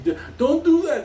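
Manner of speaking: high, loud frightened stammer; squeaky mock fear; panicked plea. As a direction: beg him to stop, voice jumping up. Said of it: "Beanie said".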